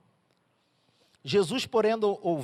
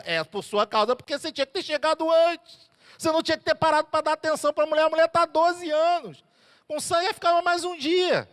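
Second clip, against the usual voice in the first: higher, louder, fast scolding reply; father's rebuttal; 10 semitones higher, 5 dB louder than usual. Acted. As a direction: argue back, blaming him. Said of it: "Aí Jesus ia falar assim".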